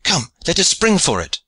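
high, eager call to action; excited high rallying cry; urgent urging. A narrator's reading. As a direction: urge a comrade on, pitch lifted. Youth urging leap after galley.

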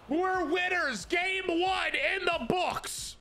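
high shouting celebration, books stretched slow; victory shout. Real, not acted. Streamer celebrating the game one win, high victory shout. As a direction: yell it out like you just won.

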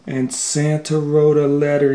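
usual speaking voice

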